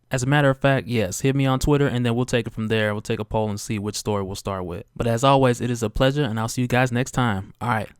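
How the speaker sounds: usual speaking voice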